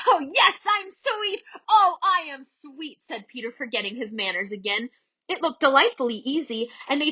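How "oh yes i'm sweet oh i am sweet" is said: high, slow, smug little boast; boastful preening. A narrator's reading. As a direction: crow about yourself like a vain boy.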